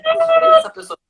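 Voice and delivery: very high squeaky repeat; mock exasperated. Telemarketer imitating herself about a rude client, high mock squeal. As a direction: shriek the line in fed-up mock disbelief. Repeated phrase, much higher.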